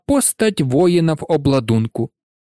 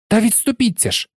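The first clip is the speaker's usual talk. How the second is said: quick, higher urgent cry; cried out; 6 semitones higher, faster than usual. A narrator's reading. Hermione crying out at the boys by the locked door. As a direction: snap it out in a hurry. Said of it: "скрикнула Герміона".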